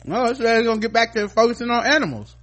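high, loud, exasperated; mock outrage. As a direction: act fed up with the network.

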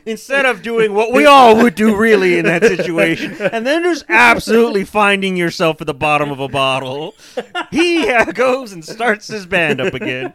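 loud, laughing mock outrage; laughing rant. Real, not acted. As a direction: crack up while mocking.

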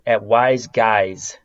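ordinary delivery

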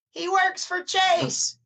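put-on high, slow, drawn-out girl's voice; girl in the crowd. Audience girl tattling to the comedian, high and slow. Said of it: "this girl behind me goes".

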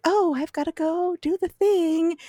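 high put-on conspiratorial voice. Podcaster mimics Willow making her excuse, high conspiratorial voice. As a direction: imitate a friend making a transparent excuse to slip away. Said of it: "gets really kind of conspiratorial".

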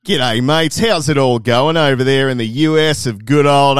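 loud, hammed-up broad Australian; exaggerated Australian greeting to Americans; broad Australian accent. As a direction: lay the Aussie on thick. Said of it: "broad Australian accents".

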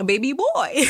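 sing-song it like a cheeky pet name; playful put-on voice. Joking to listeners mid-podcast, playful put-on voice. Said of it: "Had to do it".

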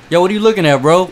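loud, high-pitched challenge; hammed-up aggressive call-out; mock confrontation. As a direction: play-act going off on a starer. Acts out confronting a starer.

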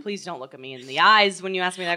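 put-on fast, prim transatlantic accent; clipped old Hollywood transatlantic accent. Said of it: "why do you have a transatlantic accent".

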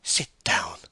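curt order to sit; brisk, even-pitched dismissal; summary command. Acted. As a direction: order it firmly, without argument. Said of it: "saw Bathsheba summarily speaking".

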